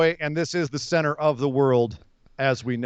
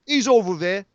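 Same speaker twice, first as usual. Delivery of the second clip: put-on Cockney; Cockney accent; 3 semitones higher than usual. Showing co-host how South London sounds, mock Cockney. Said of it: "It's Cockney".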